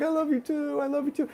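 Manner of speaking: high, soft and tender; teary reply. Retold or imitated. To her sister on the phone, high, soft and tender. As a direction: answer back gently, a little choked up. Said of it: "And I was like".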